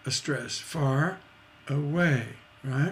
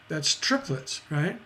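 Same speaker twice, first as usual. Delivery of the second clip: normal talking voice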